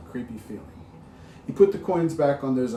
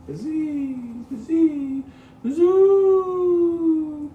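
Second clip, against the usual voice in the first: high howl growing louder; wind building to a howl; 11 dB louder, much slower than usual. A narrator's reading. Narrator for children, wind building to a loud howl. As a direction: let the wind rise and roar. Wind sound in ghost story.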